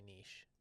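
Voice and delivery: "niche" said as "neesh"; "niche" rhyming with "leash"; elegant pronunciation. Shown as a demonstration. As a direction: say "niche" the French way, long ee, soft sh. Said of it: "more elegant when you say niche".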